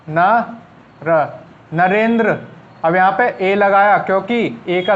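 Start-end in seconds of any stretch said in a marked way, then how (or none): 0.1-2.4 Narendra stretched out slowly, syllable by syllable; slow dictation. Shown as a demonstration. Teacher writing Narendra on the board for students, slowly drawn out. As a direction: draw out Narendra slowly as you write it. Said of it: "Narendra किस तरीके से लिखना है".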